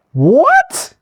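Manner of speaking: high and loud, rising in shock; disbelieving what. Real, not acted. Hearing the abuser quote Jesus, a shocked rising shout.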